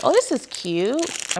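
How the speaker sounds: high, slow delighted oh; pleased surprise. Real, not acted. Vlogger unboxing a bracelet, delighted. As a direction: gush over the find.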